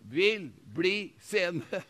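each word drawn out slowly; slow, drawn-out plea. Retold or imitated. Blind man's hesitant plea to Jesus, slow and drawn out. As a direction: stretch the words like a hesitant beggar. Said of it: "Han sa det".

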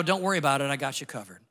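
soft, low, gentle fatherly reassurance; reassuring. Retold or imitated. Father telling his son he has it covered, softly reassuring. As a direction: ease his son's fear quietly.

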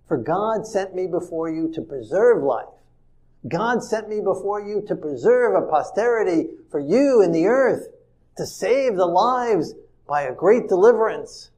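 loud, declaiming scripture reading. Acted. Preacher reads the verse aloud to his congregation, declaiming.

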